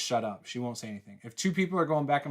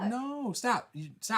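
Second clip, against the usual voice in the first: protesting refusal; 8 semitones higher, slower than usual. Real, not acted. Squabble, refusing to look it up now.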